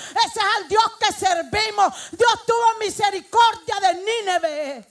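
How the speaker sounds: loud, fervent preaching that keeps climbing; full-force preaching. Real, not acted. Preacher pleading to God for mercy on the humbled, full-force preaching. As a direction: thunder it out, drawing out the big closing phrases.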